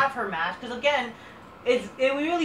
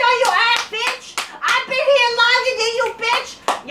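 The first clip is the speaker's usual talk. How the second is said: much higher, louder caricature voice demanding her match; mock Naomi rant; 11 semitones higher, 5 dB louder than usual. Ranting wrestler demanding her missing match, high hammed-up shriek. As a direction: ham up a shrieking diva whining about her match. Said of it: "Naomi's like".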